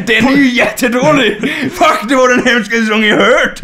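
hammed-up disgust at the song; mock outraged shout. Ranting to friend about the worst song, mock shout. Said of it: "mock outrage at the song".

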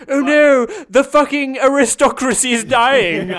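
high, loud melodramatic wailing; mock lament. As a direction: ham up the despair.

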